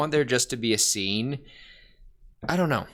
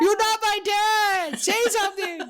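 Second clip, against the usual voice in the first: hammed-up protest; 6 dB louder than usual. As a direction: squeal it loudly in mock anguish. Hammed-up confrontation over the father.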